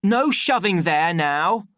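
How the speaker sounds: loud, high official's telling-off; angry shout. A narrator's reading. Official to the shoving deltas, angry shout. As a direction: shout down a pushing crowd. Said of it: "shouted the official angrily".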